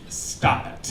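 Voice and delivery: ordinary delivery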